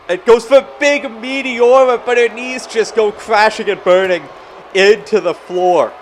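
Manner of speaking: high, loud, urgent call; excited call. Acted. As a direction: shout the big dive. Big dive to the floor.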